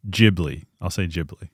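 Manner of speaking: Ghibli with a soft G, as "JIB-lee". Real, not acted. Film fan picks a side in the debate, soft-G Ghibli. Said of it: "Is it Ghibli or Ghibli?".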